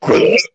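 short, loud gurgling rumble; imitated stomach noise. Storyteller mimics what he heard while driving, imitated stomach noise. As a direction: make a sudden gut-rumble noise. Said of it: "all of a sudden you just hear".

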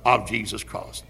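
full-force revival preaching, rising and booming on key words; fiery preaching. Acted. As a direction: thunder it from the pulpit. Preaching on the blood atonement.

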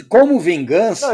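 anúncio enfático. Acted. Announcing revenge segment.

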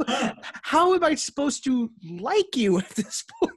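high, incredulous plea; exasperated disbelief. Acted. Fan asking Ferrari how to like them, exasperated disbelief. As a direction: plead it with the pitch riding up.

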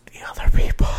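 hushed whisper so others won't hear; library whisper. Real, not acted. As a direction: whisper it, people in the next room. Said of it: "we're whispering".

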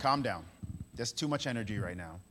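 low, dry deadpan; unimpressed Brooklynite. Acted. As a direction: damp down someone's excitement. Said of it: "Mary was from Brooklyn, New York".

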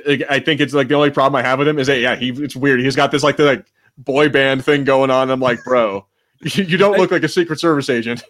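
ordinary talk, nothing marked

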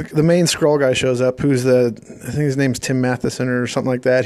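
normal talking voice

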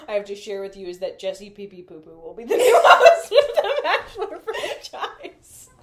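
mock announcement breaking into laughter; cracking up mid-quote; laughing. Quoting host, laughing.

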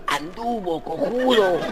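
a touch of a hushed prompt; whispered correction. Acted. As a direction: hiss the fix from below. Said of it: "el cura lo corrige". (corrección susurrada y breve)

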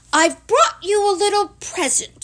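high, loud excited Pooh; bubbling, high-pitched excitement. A narrator's reading. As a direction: burst out with news of a present. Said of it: "said Pooh excitedly".

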